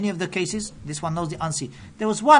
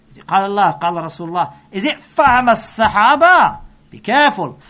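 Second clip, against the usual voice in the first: louder, forceful challenge; fervent warning; 9 dB louder, faster than usual. Real, not acted. Preacher warning congregation about storytellers, loud and urgent. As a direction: drive the warning home.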